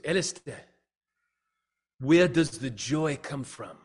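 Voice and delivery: slow, loud stab at South African, sliding toward Australian; badly faked South African accent; botched South African accent. South African colleague asks Alistair about joy, in botched accent. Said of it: "that was my best Australian".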